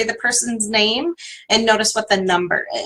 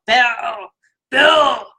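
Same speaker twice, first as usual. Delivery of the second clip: mock crying shout of the name; exaggerated frustrated wail. Shown as a demonstration. Teacher shows how to vent frustration with a mock wailing shout. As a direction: ham up a whiny frustrated cry. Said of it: "you can exaggerate the sound of it".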